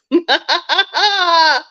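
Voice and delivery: a short laugh at the irony; wry chuckle; ironic laugh. Real, not acted. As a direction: laugh at how absurd it is.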